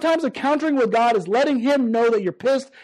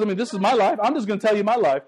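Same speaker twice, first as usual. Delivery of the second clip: ordinary delivery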